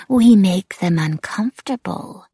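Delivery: high, slow, creepy lilt; eerie taunting wraith. A narrator's reading. Wraith leans in at the narrator's ear, eerie and taunting. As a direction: savour it slowly, high and eerie.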